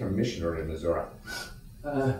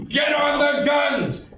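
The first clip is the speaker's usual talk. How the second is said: shouted order; 4 dB louder, much slower than usual. Acted. Repeated battlefield order.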